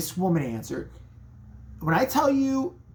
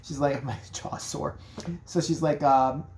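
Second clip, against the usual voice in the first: ordinary talk, nothing marked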